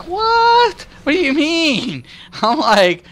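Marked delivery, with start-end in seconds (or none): 0.1-2.0 mock surprise.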